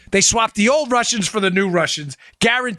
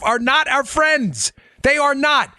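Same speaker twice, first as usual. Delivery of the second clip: slow, each word hammered home; emphatic insistence; 5 semitones higher, slower than usual. Real, not acted. Host warning listeners, slow emphatic insistence. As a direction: drive it in word by word. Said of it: "I can't say this enough".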